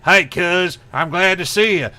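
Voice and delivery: loud, high mock Nebraskan greeting; hammed-up country cousin voice; Nebraska cousin. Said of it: "I got a cousin who's from Nebraska".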